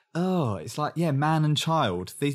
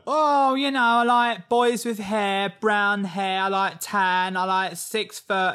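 high-pitched, drawn-out parody of dating chat; mock contestant small talk; 6 semitones higher, slower than usual. Mocking every new contestant's first chat, high singsong parody. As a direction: stretch out the list in a higher mocking voice.

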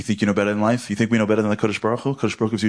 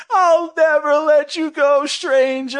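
very high, loud, slow melodrama; hammed-up heroic vow; 5 dB louder, much slower than usual. Speaker imagines rescuing a stranger, high loud melodrama. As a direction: ham it up like a movie hero.

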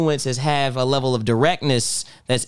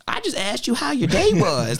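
much higher, defensive plea; baffled innocent retort; exasperated protest; 10 semitones higher than usual. Retold or imitated. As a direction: insist you meant nothing by it. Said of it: "I'm like".

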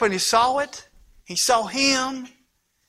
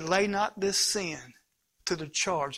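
hushed dying words; 7 semitones lower, 11 dB quieter than usual. Acted. Stephen's dying words quoted.

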